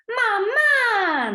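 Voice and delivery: put-on high, falling mamá like a hurt toddler; a child's hurt call of mamá, pitched high and falling; reproachful child. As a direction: complain to mom for leaving you. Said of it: "Mamá, ¿por qué me dejaste?".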